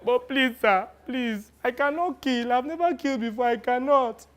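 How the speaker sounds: high, small begging voice; boy refusing to kill, pleading. Retold or imitated. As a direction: beg not to be made to kill.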